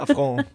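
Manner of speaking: Affront said the French way, nasal on-ending and a rising pitch; French pronunciation. Shown as a demonstration. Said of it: "Das haben auch die Franzosen so eingeführt, dass man sagt".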